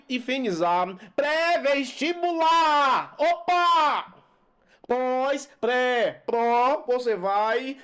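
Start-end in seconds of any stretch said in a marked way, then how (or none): 3.2-4.1 loud bright high exclamation; excited opa. Shown as a demonstration. Teacher spotting a hyphen, excited opa. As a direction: pop it out like a catch. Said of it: "hyphen rule demo".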